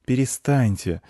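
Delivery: put-on high child's scolding; reproachful. A narrator's reading. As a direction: rebuke them as a well-mannered boy. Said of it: "сказал укоризненно мальчик". (укоризненный детский голос)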